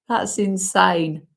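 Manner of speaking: ordinary talk, nothing marked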